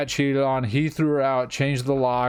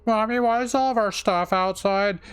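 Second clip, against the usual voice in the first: put-on much higher, childish voice; child's voice; 10 semitones higher than usual. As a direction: voice a worried little kid. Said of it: "her kid's like".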